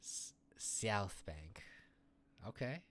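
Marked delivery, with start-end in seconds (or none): 0.0-1.6 very slow "South Bank", S hissed hard. Shown as a demonstration. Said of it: "he really goes hard on the S".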